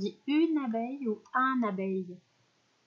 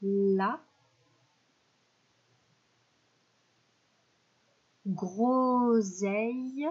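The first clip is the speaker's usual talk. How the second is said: slow checking; much slower than usual. Shown as a demonstration. Teacher checking the sentence word by word, slow. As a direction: stretch each word slowly with pauses. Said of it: "on va écrire la phrase".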